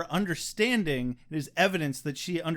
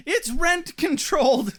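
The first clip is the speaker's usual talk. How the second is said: gleeful shriek; 11 semitones higher, 9 dB louder than usual. Retold or imitated. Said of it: "Angel's like".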